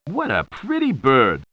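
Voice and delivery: ordinary talk, nothing marked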